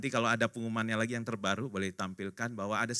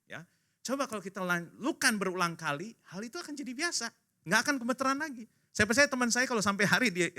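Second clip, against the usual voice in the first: ordinary talk, nothing marked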